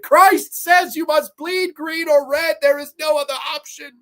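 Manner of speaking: hammed-up religious pronouncement; mock preacher decree. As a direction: thunder it out like holy law.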